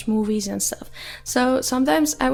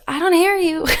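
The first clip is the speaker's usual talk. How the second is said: higher, louder teasing complaint; mock can't-hear-you; 7 semitones higher, 6 dB louder than usual. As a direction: tease like a friend calling across the room. Mimicking people who can't hear her.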